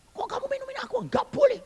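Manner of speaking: very high, shrill-pitched scolding; a doctor suddenly alarmed. Retold or imitated. Preacher voicing the doctor scolding about the water, very high. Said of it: "dokternya tahu".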